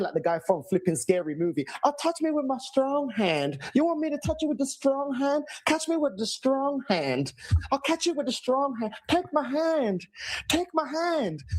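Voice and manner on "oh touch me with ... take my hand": creepy mock manager voice. Fan mocking the manager's strong hand, creepy high voice. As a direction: lure them in with a creepy high voice.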